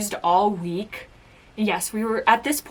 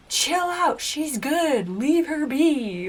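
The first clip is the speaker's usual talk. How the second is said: higher, insistent retold defence; defending a friend; 6 semitones higher, much slower than usual. Teammates telling bullies to back off, retold defending. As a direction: stand up for her, drawing out the last words. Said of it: "was defending me".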